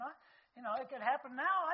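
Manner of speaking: ordinary talk, nothing marked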